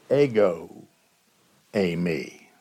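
"Ego eimi" said EH-go AY-mee, dropping low; Greek ego eimi. Shown as a demonstration. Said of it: "You say".

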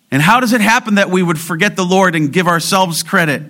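high, loud, urgent pulpit delivery; impassioned sermon climax; preaching at full force. Acted. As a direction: drive the warning home to the church. Preaching on crediting ourselves.

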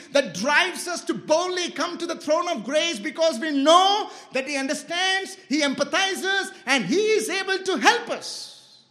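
high, forceful sermon delivery; climactic preaching. Real, not acted. Preacher urging boldness before the throne of grace, impassioned. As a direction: build the congregation to a rousing finish.

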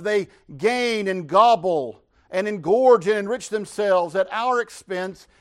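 fiery sermon; preaching at full force. Acted. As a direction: build the sermon to a passionate peak.

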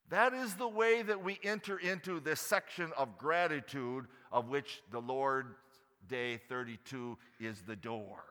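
normal talking voice

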